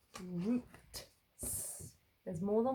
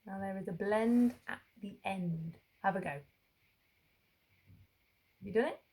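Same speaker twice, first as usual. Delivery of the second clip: normal talking voice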